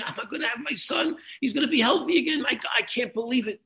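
high, joyful and overflowing; a father who can't contain his relief, pitched high; beaming father. Retold or imitated. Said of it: "and the father is beaming".

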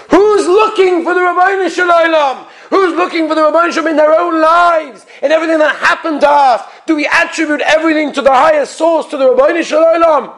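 high, ringing preacher's cry; fervent, high-pitched exhortation; impassioned outcry. Real, not acted. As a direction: cry out the challenge to the congregation. Preacher cries out the lesson.

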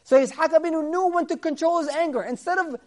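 loud, high, driving sermon voice; fervent preaching. Acted. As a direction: proclaim it to the whole room. Preacher quotes verse, builds fervor.

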